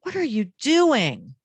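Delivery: doing punched high and loud, then falling; slow question leaning hard on doing; stress on doing. Shown as a demonstration. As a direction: draw out doing with a big high push. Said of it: "place the emphasis somewhere else".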